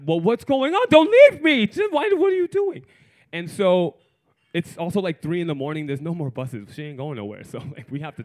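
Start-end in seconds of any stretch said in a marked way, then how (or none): 0.0-2.9 loud, very high desperate cry; panicked pleading. Acted. Lover begging the other not to leave, loud high panicked pleading. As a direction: wail it in a mock panic.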